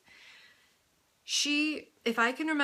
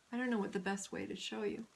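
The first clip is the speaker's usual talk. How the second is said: ordinary talk, nothing marked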